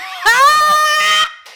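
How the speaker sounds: loud, very high laughing shriek; shrieking laughter. Real, not acted. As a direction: explode into screaming laughter. Cracking up over voice note.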